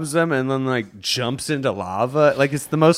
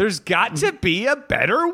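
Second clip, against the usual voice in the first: high pitchman voice; infomercial; 6 semitones higher than usual. Acted. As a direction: sell it like a TV ad. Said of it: "It's like an infomercial".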